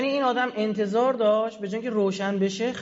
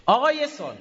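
ordinary talk, nothing marked

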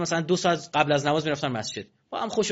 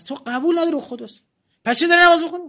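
much higher and louder scolding question; indignant rebuke; 12 semitones higher, 7 dB louder than usual. Acted. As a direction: scold him for praying without respect. Preacher scolds the careless worshipper. (سرزنش با صدای بلند و تند)

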